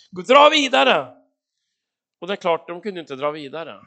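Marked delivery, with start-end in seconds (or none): 0.1-1.1 loud, high, urgent order; God's command. Acted. God sending Moses and Israel onward, loud command. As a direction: order them onward forcefully.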